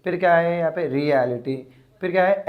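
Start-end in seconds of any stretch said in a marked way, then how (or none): none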